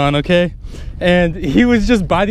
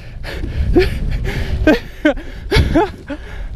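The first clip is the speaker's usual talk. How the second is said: airy giddy laugh; breathless delighted laugh; 10 semitones higher, 3 dB quieter than usual. Real, not acted. Angler laughing at his huge catch, breathless. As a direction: laugh out of disbelief.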